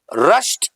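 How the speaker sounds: rushed ending on a t, "rusht"; right pronunciation. Shown as a demonstration. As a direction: say रश्ड in one syllable. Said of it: "right pronunciation". (रश्ड को "रश्ट" जैसा)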